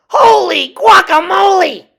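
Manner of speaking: high, loud astonished cry; exclaimed. A narrator's reading. Kitten startled by the mirror, loud high exclamation. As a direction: blurt it in amazement. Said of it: "exclaimed Skippy John Jones".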